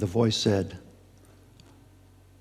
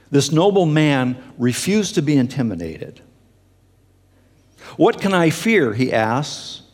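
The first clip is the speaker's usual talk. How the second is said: loud, higher, ringing declamation; impassioned quotation reading; 5 semitones higher, 8 dB louder than usual. Acted. Preacher reads an exiled church father's defiance, impassioned declamation. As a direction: proclaim it forcefully, questions rising high.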